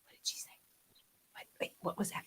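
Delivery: unvoiced, curious whisper; straining whisper. Shown as a demonstration. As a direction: lean in and whisper. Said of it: "then I have to go".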